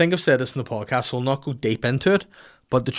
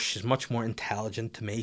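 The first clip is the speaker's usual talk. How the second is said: normal talking voice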